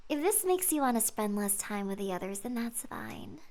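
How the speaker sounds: soft unvoiced whisper. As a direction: whisper it, confiding. Monika confiding softly.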